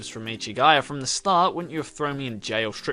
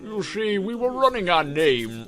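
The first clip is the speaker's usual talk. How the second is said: slow drawling oily voice; old detective explaining the call, slow and smug; smarmy detective; 4 dB louder, slower than usual. Acted. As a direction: stretch each word smugly. Detective voice slowed.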